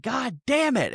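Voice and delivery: high-pitched imitation of an outburst; mock freak-out. Podcaster retelling Phil freaking out in the movie, mock outburst. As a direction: act out a man losing it, without real volume. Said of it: "Phil starts f-, freaking out".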